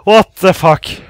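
loud, high shocked swearing; shocked yell. Real, not acted. Player just jump-scared, shocked yell. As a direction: yell it straight out of a real fright.

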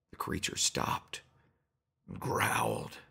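plain reading voice

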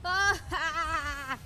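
loud high yelp; startled scream. Real, not acted. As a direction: yelp in alarm. Caught by hunter.

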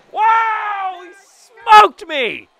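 high, loud outraged yell at being hit. Real, not acted. Gamer yelling after his player gets plunked. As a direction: yell it out, stung and indignant.

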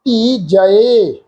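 ਧੀ ਜਏ said very slowly, ending in a separate "e"; correct pronunciation. Shown as a demonstration. Teacher models the correct reading ਧੀ ਜਏ slowly. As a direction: stretch out ਧੀ ਜਏ, "dhee ja-e". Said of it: "ਜਿਵੇਂ ਆਹ ਸ਼ਬਦ ਆ".

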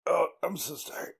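ordinary delivery

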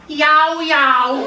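puppy yelping instead of barking; shrill-sounding yelp; loud yelp. Acted. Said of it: "nechce slyšet žádné au".

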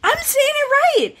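high, insistent; indignant. Real, not acted. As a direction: protest that you're right.

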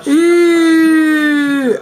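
long drawn-out loud call. Real, not acted. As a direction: stretch it out, announcing. Calling out to the camera.

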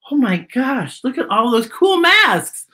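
delighted storytime aside; excited. Real, not acted. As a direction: gush over the picture. Reacting to the illustration.

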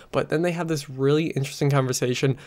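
normal talking voice

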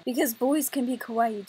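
ordinary talk

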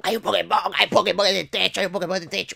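leve imitación de niño alborotado; repítelo sin parar, como un primito emocionado; niño vuelto loco. Retold or imitated. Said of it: "mi primate se pone loco". (imitación de niño alocado, sin gritar)

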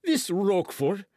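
high, heated outburst; blustering Porthos exclamation; crying out. A narrator's reading. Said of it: "cried Porthos".

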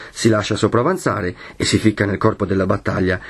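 usual speaking voice

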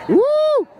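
high loud woo; whoop. Real, not acted. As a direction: whoop at the fireworks. Celebrating fireworks.

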